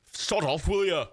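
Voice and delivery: moderately high Liverpool accent; middle-pitched Scouse attempt. Shown as a demonstration. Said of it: "I mean, I'll try".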